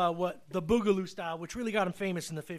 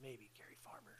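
whisper; 8 semitones lower, 23 dB quieter than usual. Real, not acted. Whispered aside after joking.

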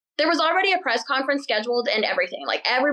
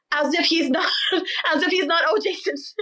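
higher-pitched, breaking up with real laughter; laughing while talking; 5 semitones higher than usual. Real, not acted. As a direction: giggle through the words, restarting after a laugh. Cracks up at the disguise.

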